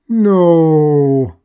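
loud high shouted no; Death's furious bellow. A narrator's reading. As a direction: roar it in outrage. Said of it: "Death bellowed".

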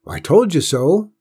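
put-on triumphant, gleeful boy; ecstatic. A narrator's reading. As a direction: crow it with delight. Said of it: "John Robert said ecstatically".

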